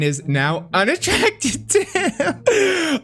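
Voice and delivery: high, slow, laughing through the words; amused laughter breaking the phrase. Real, not acted. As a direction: dissolve into giggles on it. Laughs mid-word.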